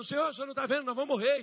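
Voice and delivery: high, loud mock panic; panicked yelling. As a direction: yell for help like disciples in a storm. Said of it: "eles estavam lá gritando".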